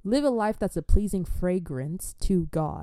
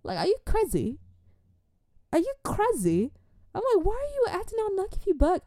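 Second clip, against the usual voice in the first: climbing higher, disbelieving; incredulous; 12 semitones higher than usual. Retold or imitated. Thinking at the rude girl, rising disbelief. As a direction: ask if she's lost her mind. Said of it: "In my head, I'm like".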